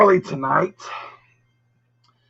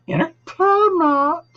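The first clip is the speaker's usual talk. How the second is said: announcer voice; 13 semitones higher, slower than usual. Pretend. Brand name in announcer voice.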